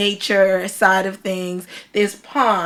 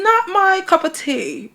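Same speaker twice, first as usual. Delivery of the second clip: says "not my cup of tea" in a light British accent; a playful British accent, "not my cup of tea"; 8 semitones higher than usual. Shown as a demonstration. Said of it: "Should I do it in a British accent?".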